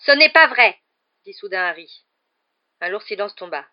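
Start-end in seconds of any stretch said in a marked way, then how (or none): 0.1-0.8 sudden loud, high outburst; protestation furieuse. A narrator's reading. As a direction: cut in with an angry, raised denial. Harry bursts out in fury. (éclat de colère soudain)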